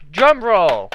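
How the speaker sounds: out-of-sync helper voice; botched drum roll. Pretend. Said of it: "You are so out of sync".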